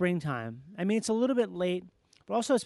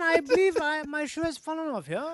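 put-on tipsy posh British accent, higher and slowed; drunken posh British accent; 4 semitones higher than usual. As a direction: slur it like a tipsy English squire. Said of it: "British guy that announces to a room that his shoe fell off".

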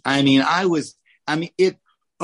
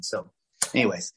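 ordinary talk, nothing marked

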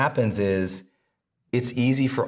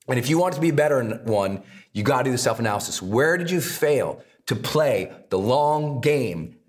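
normal talking voice

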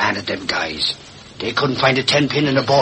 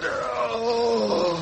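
high, weak collapsing groan; fading groan; 12 semitones higher, 7 dB quieter than usual. Acted. Gassed reporter collapsing with a faint groan. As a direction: let out a groan as you pass out.

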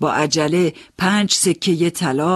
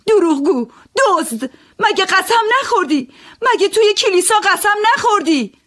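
very high, loud accusing shout; shrieking accusation at the oath-breaker; 7 dB louder than usual. Acted. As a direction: hurl the accusations at him. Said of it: "داد زدم".

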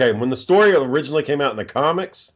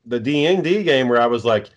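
ordinary talk, nothing marked

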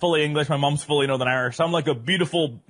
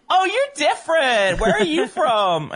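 high, slow, drawn-out mock curiosity; curious Uber driver; 12 semitones higher, 4 dB louder, slower than usual. A driver squinting in the mirror, high and slow curiosity. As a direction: play the puzzled driver, pitched way up and stretched.

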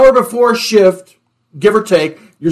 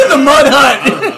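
slow, through real laughter; repeating the joke while laughing; 5 semitones higher, much slower than usual. Real, not acted.

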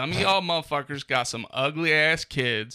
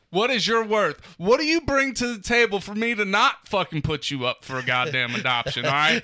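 mock angry rant; 4 semitones higher, 4 dB louder than usual. Mock rant at his kids.

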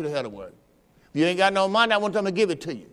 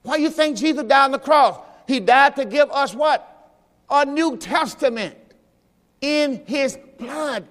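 climactic preaching; 6 semitones higher, slower than usual. Real, not acted.

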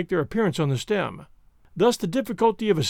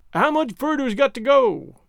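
higher halloo from afar, mildly raised; 6 semitones higher, 4 dB louder than usual. A narrator's reading. Climber calling down from the tree, hallooing. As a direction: call down as if from far up. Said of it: "heard in a sort of hello".

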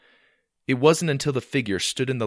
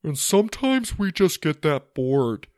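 higher-pitched character voice; hairy friend; 7 semitones higher, 3 dB louder than usual. Acted. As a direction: voice the massive hairy friend speaking up. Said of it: "he said in perfect English".

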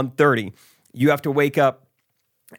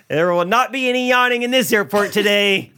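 mock agent decree; 7 semitones higher than usual. Voicing the agent again.

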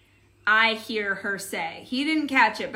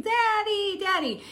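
put-on high toddler voice calling out; 10 semitones higher than usual. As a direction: call for daddy like a little girl.

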